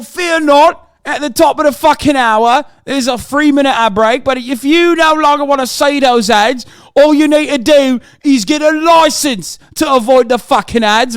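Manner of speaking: put-on British accent, all cheeky lad banter; mock British lad accent. Streamer thanking subs, still in his mocking British accent.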